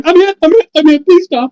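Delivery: high mock panic, voice jumping up; panicked cries. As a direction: squeal it like you've been hit. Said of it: "behind the wall going".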